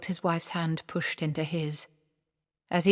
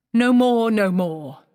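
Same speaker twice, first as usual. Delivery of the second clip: no more; 6 semitones higher, 11 dB louder, slower than usual. Acted. Manager claps to end the spell.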